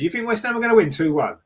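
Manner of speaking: high, disbelieving question; incredulous. Real, not acted. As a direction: question the viewer's absurd prediction. Disbelieves viewer's West Ham prediction.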